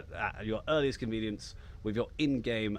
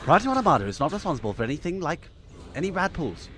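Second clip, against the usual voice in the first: mock ad small print at a brisk, raised pitch; fast commercial disclaimer; 6 semitones higher, 7 dB louder, faster than usual. Streamer mimicking commercials' disclaimers, fast and loud. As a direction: rattle it off quick, high and loud. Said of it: "you gotta say it fast, like in the commercials".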